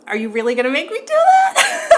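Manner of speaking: high, loud, half-laughing disbelief; laughing protest. Real, not acted. Asked to do a chuff.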